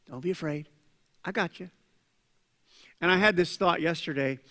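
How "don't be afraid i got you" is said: softer, gentle and close; gentle reassurance. Acted. Jesus to Jairus in the preacher's own words, softly reassuring. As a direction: say it quietly like a promise.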